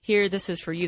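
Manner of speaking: ordinary talk, nothing marked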